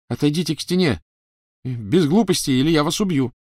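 high, loud trembling threat; shaky threat. A narrator's reading. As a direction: threaten while scared. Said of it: "дрожащий голос Драко".

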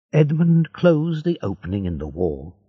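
ordinary delivery

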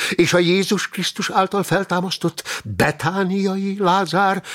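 high, fervent character voice; keyed-up, insistent exposition; excited. Acted. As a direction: argue it excitedly, pitched well up. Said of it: "kezdte izgatottan csillogó szemmel".

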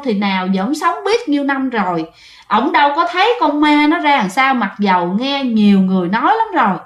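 usual speaking voice

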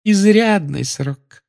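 high, slow, sweet official; drawn out with prim sweetness; slow sugary Umbridge. A narrator's reading. Umbridge voiced by narrator.

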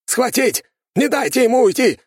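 high, loud commanding shout; shouted order. A narrator's reading. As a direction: yell the order. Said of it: "вдруг опомнился Темус".

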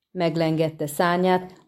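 plain reading voice

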